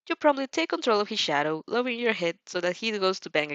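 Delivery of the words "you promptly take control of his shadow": usual speaking voice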